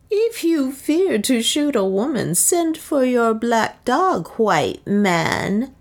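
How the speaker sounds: high, slow, drawn-out goading; mocking. A narrator's reading. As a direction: dare them with open scorn. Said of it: "mocked Soa".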